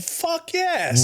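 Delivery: high, loud, emphatic agreement; cheering on choking cops; enthusiastic yes. Real, not acted. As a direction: fire it back with glee. Enthusiastic answer to viewer.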